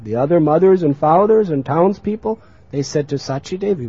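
ordinary talk, nothing marked

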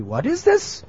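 very high incredulous question; shocked reproach. Acted. Neighbours confront the mother over her son, shocked reproach. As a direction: ask it in high-pitched disbelief.

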